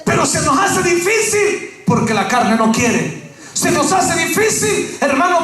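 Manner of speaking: rising, shouted preacher's cry, slow at the peak; preaching at full force. Acted. Pastor to his church, preaching at full force. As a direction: lift the congregation with a booming shout.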